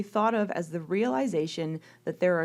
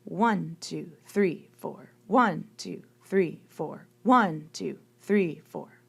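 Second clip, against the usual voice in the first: "One" punched louder, two three four lighter; counting quadruple meter; 3 dB louder, slower than usual. Shown as a demonstration. Music teacher counting four beats for students, one stressed. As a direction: hit each downbeat, let the rest fall back. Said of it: "Quadruple meter would be counted like this".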